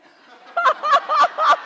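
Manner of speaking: high, helpless burst of laughter; big real belly laugh; loud laughter. Real, not acted. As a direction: crack up at your own slip. Cracks up at own accent slip.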